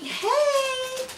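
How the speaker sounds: high, drawn-out hey; tentative greeting. Retold or imitated. Asking the leasing office about a neighbor, tentative. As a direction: ease in with a high-pitched greeting.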